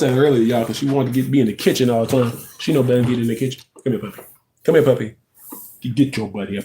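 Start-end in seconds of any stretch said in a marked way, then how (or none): none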